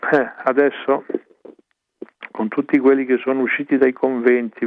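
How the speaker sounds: ordinary delivery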